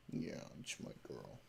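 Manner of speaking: soft whisper to the dog; tender whispered praise. Real, not acted. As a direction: whisper it fondly.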